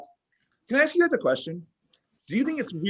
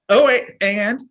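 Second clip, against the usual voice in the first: excited realization; 5 semitones higher, 7 dB louder, slower than usual. Acted. Sudden realization.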